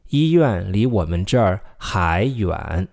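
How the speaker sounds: slow, careful read of 医院离我们这儿还远 without 呢; slow model sentence. Shown as a demonstration. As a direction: model it slowly for repeating. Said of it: "还" means still".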